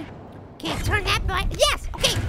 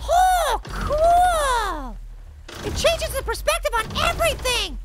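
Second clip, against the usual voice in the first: thrilled amazement; 4 semitones higher, slower than usual. Real, not acted. Player watches the perspective flip, thrilled amazement. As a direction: gush it in big long vowels.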